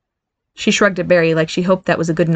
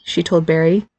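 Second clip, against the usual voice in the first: ordinary delivery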